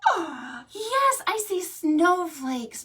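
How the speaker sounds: high, loud oh yes; high, amazed discovery; excited discovery. Acted. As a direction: marvel at the pictures. Discovering book pictures.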